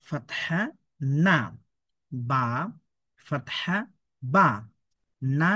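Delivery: measured chant with stretched letter names; spelling drill recitation. Shown as a demonstration. Teacher drills noon syllables for learners, measured drill recitation. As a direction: recite letter, vowel, then syllable, pausing between.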